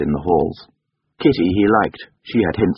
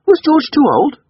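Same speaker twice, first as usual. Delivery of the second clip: put-on high, loud questioning boy's voice; young master; 7 dB louder than usual. A narrator's reading. Boy questioning the coachman in the garden, high childish query. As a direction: ask as a small boy, pitched high.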